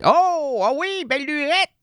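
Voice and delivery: "belle lurette" sung out high and bright; high exclaiming lurette. Shown as a demonstration. Savouring the old phrase, exclaimed high. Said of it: "depuis belle lurette".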